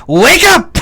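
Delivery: very loud, high holler; yell. Acted. Fighter rousing sleeping companions, full yell. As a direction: shout to rouse everyone. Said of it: "I will yell".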